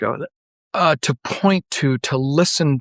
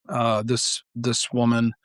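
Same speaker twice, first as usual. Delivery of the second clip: ordinary delivery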